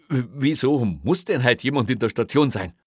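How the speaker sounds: high, loud, stuttered question; agitated objection. Acted. Officer argues with boss about staffing the station, agitated. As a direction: challenge it in exasperation. Argues against the night shift.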